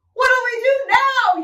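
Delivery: high, loud, drawn-out plea; yelling. Acted. Pirate asks shipmates during the storm, yelling. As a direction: yell it out in a storm panic. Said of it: "yelled one of the pirates".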